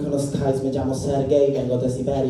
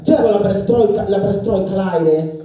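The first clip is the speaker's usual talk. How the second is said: higher, louder panicked cry; alarmed outburst; 7 semitones higher, 7 dB louder than usual. Retold or imitated. Sergei realising in the street, loud and panicked. Realising his fly is open.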